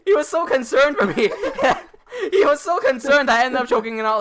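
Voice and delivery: high, loud laughing through the words; can barely get it out for laughing; cracking up mid-story. Real, not acted. Laughing retelling friend's concern.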